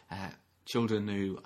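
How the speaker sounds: ordinary talk, nothing marked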